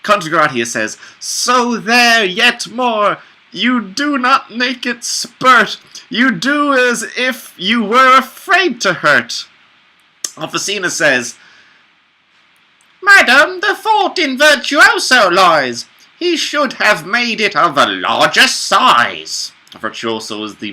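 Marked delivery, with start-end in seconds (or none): 1.2-9.5 high character voice. Acted. Contagratia's line read in voice. 13.0-19.5 high character voice. Acted. Officina's line read in voice.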